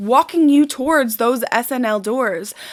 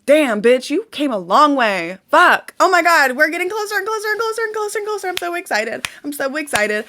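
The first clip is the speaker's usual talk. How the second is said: much higher and fast, bubbling over; cheering yourself on, higher and faster; excited self-hype; 4 semitones higher than usual. Pretend. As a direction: squeal with road-trip glee. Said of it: "Or do I wanna say".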